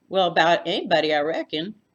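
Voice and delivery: loud, dry South Texas quip; deadpan it like a Texas lady. A narrator's reading. Floy's voice.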